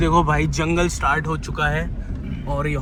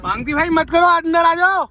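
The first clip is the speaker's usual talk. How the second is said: higher, louder call to a friend outside the car; 11 semitones higher, 5 dB louder than usual. Real, not acted. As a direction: shout him back in, worried. Calling friend back inside car.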